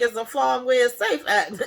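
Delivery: cracking up mid-sentence, high and loud; laughing. Real, not acted. Retelling the Safe Farm joke, laughing so hard the words break. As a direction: laugh through the punchline. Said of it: "that had me cracking up".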